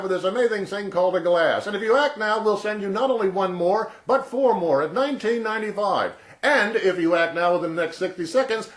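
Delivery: high, loud, hard-sell shouting; over-the-top infomercial barker, high and loud; yelling TV pitchman. Retold or imitated. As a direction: yell the offer like a late-night ad. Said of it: "always yelling at you".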